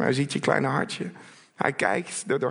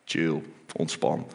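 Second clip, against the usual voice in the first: low, soft, slow and relaxed; laid-back calm; 8 semitones lower, 4 dB quieter than usual. Acted. Preacher mimics what Jesus does not say, laid-back calm. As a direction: wind right down, letting each word drop. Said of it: "hij zegt niet".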